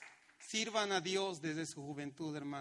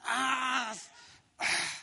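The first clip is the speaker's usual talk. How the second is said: mock cheer; 6 semitones higher, 8 dB louder than usual. Cheering at football news.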